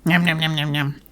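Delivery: chewing crisps while talking; crunching chips. Real, not acted. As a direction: mouth full of crisps. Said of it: "I'm munching on some crisps".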